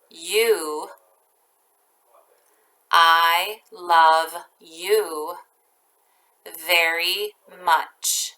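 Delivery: each chunk very slow with long pauses; teacher dictating a sentence to first graders, slowly; slow dictation. Shown as a demonstration. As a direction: dictate it piece by piece. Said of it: "I'll read each part very slowly".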